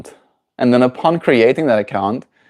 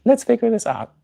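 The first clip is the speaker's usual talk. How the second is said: much higher, lightly voiced helpful woman; mimicking a patient clerk, pitched way up; imitated helpful lady; 12 semitones higher than usual. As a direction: put on a high voice for the kind helper. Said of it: "and she's like".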